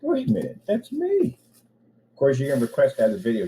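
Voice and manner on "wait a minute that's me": mock realisation. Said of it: "I'm like".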